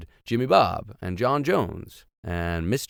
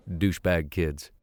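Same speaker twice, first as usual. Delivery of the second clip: usual speaking voice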